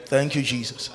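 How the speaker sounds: low, soft thanks to Jesus; hushed devotional tone; quiet prayer. Real, not acted. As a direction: pray it softly and reverently, voice dropped low. Prays quietly during congregational worship.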